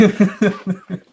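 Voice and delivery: high, open laugh; delighted laugh. Real, not acted. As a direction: burst out laughing after the exclamation. Laughs at her own breakthrough.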